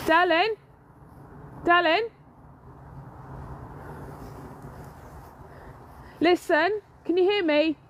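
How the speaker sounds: warning him from afar; calling out. Real, not acted. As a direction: call out to your husband in the distance. Calling to husband far off.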